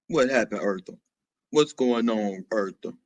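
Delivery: very low, soft deep register. Acted. Asking Eartha what's going on, soft deep voice. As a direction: greet them softly from way down low. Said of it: "What in the deep voice".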